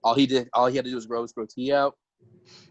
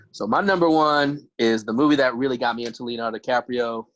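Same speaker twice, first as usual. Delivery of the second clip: ordinary delivery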